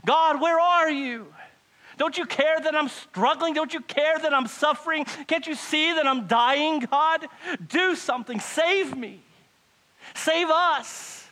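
loud, high, desperate cry to God; anguished shouted prayer. Acted. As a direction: plead at the top of your voice. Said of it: "so desperate that you have prayed that prayer".

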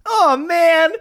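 high, pouty "oh man", hammed up; lightly voiced sulky hero; mock whiny hero. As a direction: whine it like a sulking kid. Hero sulks off after losing.

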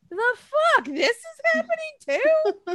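high, shocked squeal; can't believe your luck; stunned disbelief. Retold or imitated. Reliving the surprise match, a high shocked squeal. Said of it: "I'm like".

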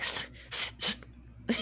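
whispered cat hiss, sss sss sss. Retold or imitated. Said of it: "sniffing at her".

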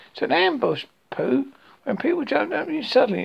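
Piglet. A narrator's reading. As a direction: pipe up to pass on the explanation. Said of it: "explained Piglet".

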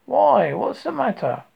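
Pooh's anxious question; concerned bear. Acted. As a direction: ask like a fretting small bear.

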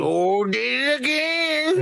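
high, drawn-out gushing; sing-song, hammed-up self-congratulation; mock delight. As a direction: stretch it out in a thrilled fake voice. Mocking delighted studio executives.

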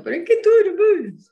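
high, loud tearful protest; a wife's high tearful plea to her husband; crying plea. Retold or imitated. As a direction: plead through tears, pitch way up. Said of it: "Y yo llorando".

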